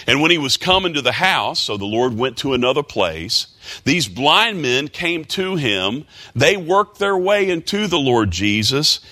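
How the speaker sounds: high, urgent retelling; fervent preaching. Real, not acted. Preacher retelling the healing, high and urgent. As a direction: press the story on.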